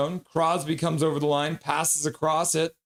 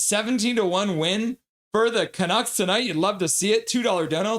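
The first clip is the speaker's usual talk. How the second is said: higher, lilting cheer; excited message read-out; 6 semitones higher than usual. Retold or imitated. Host reading a viewer's donation message aloud, excited. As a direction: read a fan's message with upbeat lift.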